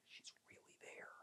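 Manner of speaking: hushed, barely audible whisper; awed whisper. Retold or imitated. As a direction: whisper it to yourself in disbelief. His awed thought over the menu.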